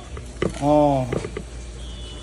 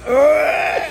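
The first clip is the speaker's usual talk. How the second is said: pained cry; 6 semitones higher, 5 dB louder than usual. Real, not acted. Nephew reacts to salty taste.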